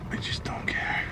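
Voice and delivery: whispered, giving up; defeated whisper. Real, not acted. Giving up on the oil mess, defeated whisper. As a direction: breathe it out, beaten.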